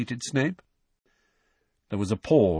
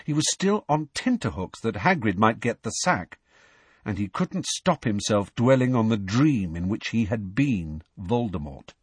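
ordinary delivery

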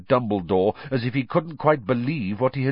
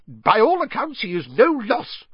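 high, loud dismissive bluster; 13 semitones higher, 4 dB louder than usual. Acted. Fudge blustering to Dumbledore about Crouch. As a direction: wave it off pompously. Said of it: "blustered Fudge".

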